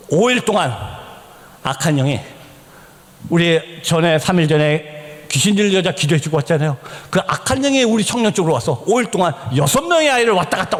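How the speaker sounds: ordinary talk, nothing marked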